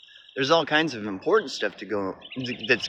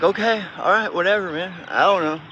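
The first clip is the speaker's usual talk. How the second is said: dismissive brush-off; 5 semitones higher than usual. Retold or imitated. Speaker brushing off defensive people, mock dismissive. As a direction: toss it off as if you couldn't care less. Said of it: "It's like".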